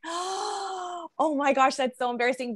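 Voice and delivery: high, drawn-out "oh my gosh" after a gasp; mock-mortified gasp. Yoga student re-enacting reaction to teacher's sassy remark, mock-mortified. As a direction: act out being embarrassed, gasping and high.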